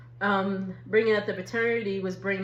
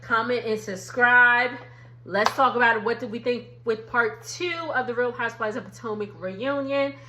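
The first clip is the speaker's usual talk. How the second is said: ordinary delivery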